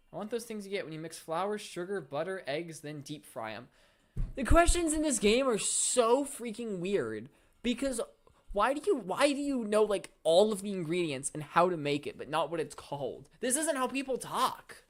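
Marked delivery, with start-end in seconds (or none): 4.4-14.8 loud, high-pitched frustrated rant at the game's weird questions; exasperated rant. Real, not acted. Player rants at the game's strange questions, loud and exasperated. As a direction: vent loudly at how unnatural the dialogue is.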